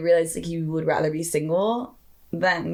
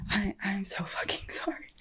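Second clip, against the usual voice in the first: whispered apology; 2 semitones higher, 8 dB quieter than usual. Retold or imitated. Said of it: "She's like".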